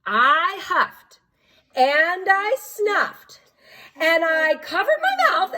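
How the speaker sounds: stretched, rising sneeze build-up; slow huff and snuff. Acted. Storyteller slowly builds the wolf's second sneeze.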